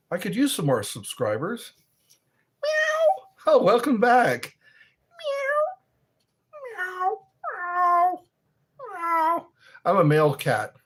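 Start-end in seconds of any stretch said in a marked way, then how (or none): 2.6-3.5 very high-pitched cat meow; imitated cat's mew. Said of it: "That sounds like a male cat in heat". 5.2-9.5 repeated high-pitched meows; imitated tomcat calling; cat meows. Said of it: "That sounds like a male cat in heat".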